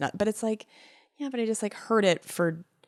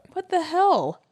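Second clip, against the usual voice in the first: higher put-on incredulous voice; mock outrage; 10 semitones higher than usual. Real, not acted. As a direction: act shocked, pitched up.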